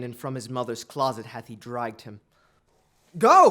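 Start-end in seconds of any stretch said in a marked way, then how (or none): 3.2-3.5 loud, high command; loud urgent command; shouted order. Acted. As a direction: bark the order. King orders the courtiers away.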